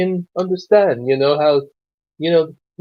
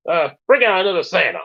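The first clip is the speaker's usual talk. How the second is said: usual speaking voice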